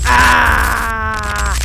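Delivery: loud yell of fright; startled scream. Real, not acted. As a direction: cry out in fright. Creature transforms again.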